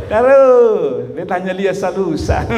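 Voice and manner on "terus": stretched-out mock goading, a bit higher; imitated mother's ominous drawl; dangerously smiling mother. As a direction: prompt the child with veiled threat. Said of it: "Tapi kalau dia sudah".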